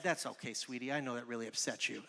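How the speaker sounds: dad coddling his daughter after she wrecked the garage; soft indulgent dad. Retold or imitated. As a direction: gently soothe the favourite child. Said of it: "And my dad said".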